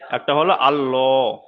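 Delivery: slow আল্লাহ with a full round heavy L; heavy rounded Allah. Shown as a demonstration. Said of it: "দেখেন মুখটা গোল হয়ে যাচ্ছে".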